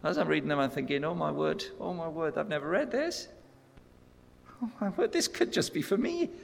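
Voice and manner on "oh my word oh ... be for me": rising higher with amazed excitement; breathless, climbing wonder at discovery; a retold thrill building to a high peak; excited wonder. Retelling his excited discovery.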